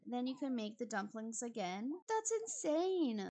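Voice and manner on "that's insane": higher, louder, slow disbelief; incredulous. Real, not acted. Player reacting to the guest's behaviour, incredulous. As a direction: let the disbelief burst out.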